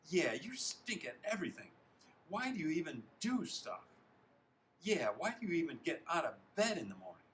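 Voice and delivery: high, loud scornful jeering; piling on. A narrator's reading. Teammates pile on the loser, jeering. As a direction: heckle with exaggerated swoops of pitch. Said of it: "Other Purples pile on".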